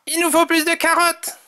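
high-pitched urgent character voice; urgent Tom. A narrator's reading. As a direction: urge it high and eager. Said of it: "Dit Tom".

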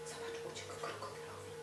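hushed whisper. Real, not acted. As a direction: share it as a little secret. Aside to child.

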